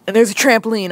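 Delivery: ordinary talk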